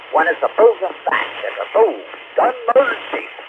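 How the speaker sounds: rising, indignant rant; indignant outburst. Real, not acted. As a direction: let the outrage spike up high. Ranting about murder, pitch jumps.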